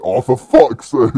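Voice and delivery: loud, high, drawn-out mock groan; imitated exasperated guard. Security guard watching her climb too, imitated loud exasperated cry. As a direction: draw it out in laughing exasperation. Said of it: "the security guard's like".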